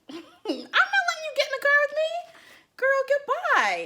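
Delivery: very high incredulous squeal; exaggerated high-pitched dismissal; mock refusal. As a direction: refuse him in a high, put-on voice. Acts out refusing him the car.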